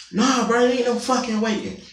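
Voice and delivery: high, mocking imitation of the angry daughter; imitating the daughter. Narrator mimics the landlady's daughter yelling at her, high mocking voice. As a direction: squeak her lines up high like a mock.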